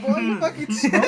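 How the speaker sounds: loud, high-pitched incredulous outburst; laughing, high, indignant yell; exasperated retort. Retold or imitated. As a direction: blurt it loudly through laughter. Retold exasperated retort to a customer.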